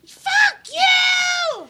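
high, loud mock shriek; imitated screaming. Sister cursing when the card fails, high mock shriek. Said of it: "She goes".

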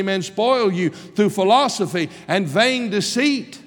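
high and loud, nearly shouting; preacher rising to a shout; full-force preaching. Acted. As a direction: thunder it from the pulpit.

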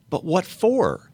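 high, loud protesting question; bewildered boy. A narrator's reading. Shasta demanding a reason from the lion, high bewildered cry. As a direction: pitch leaps up in baffled protest. Shasta asks why Erebus was wounded.

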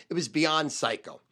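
ordinary delivery

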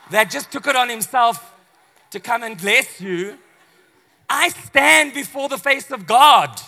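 the angel Gabriel telling off a doubting priest, loud and proud; indignant Gabriel. Retold or imitated. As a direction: declare it with affronted grandeur. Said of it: "causes the angel to do what?".

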